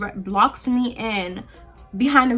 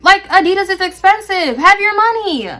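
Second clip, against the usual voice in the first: much higher and louder, indignant; angry rant; 8 semitones higher, 7 dB louder than usual. Real, not acted. Ranting at the customer who cheered him on, much higher and louder. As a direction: tear into them, fired up.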